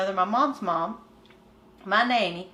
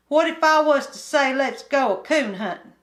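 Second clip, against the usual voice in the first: put-on higher, louder hillbilly boy complaining; bored country boy; 4 semitones higher, 4 dB louder than usual. Kim complaining to Winky about boredom, higher country boy voice. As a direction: whine it like a restless mountain kid.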